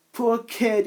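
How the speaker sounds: mild mock sob; a touch of tearful pity; understated sobbing; sobbed. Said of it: "he sobbed".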